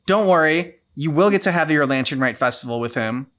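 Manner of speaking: ordinary talk, nothing marked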